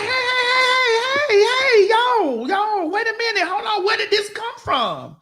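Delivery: high-pitched repeated shouts of hey; high yelling hey. Retold or imitated. Calling out to people arguing, high shouted heys. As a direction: yell high to stop them. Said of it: "I'm like".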